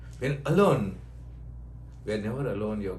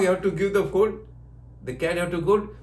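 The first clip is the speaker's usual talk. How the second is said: usual speaking voice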